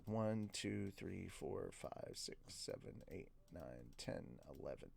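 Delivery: very low, barely audible count; counting under the breath. Real, not acted. Reader counting the pages left, under the breath. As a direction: count softly to yourself, fading almost to nothing.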